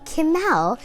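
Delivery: usual speaking voice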